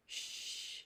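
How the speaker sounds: "sh" as one soft hushing sound; teacher showing the digraph sound. Shown as a demonstration. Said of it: "That sound is a little different".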